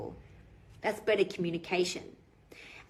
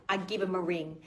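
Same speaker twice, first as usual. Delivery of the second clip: ordinary talk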